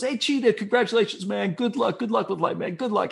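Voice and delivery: high-pitched, hearty party congratulations; breezy brush-off. Retold or imitated. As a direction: imitate him brushing someone off with high, rattled-off cheer. Friend brushes her off at party.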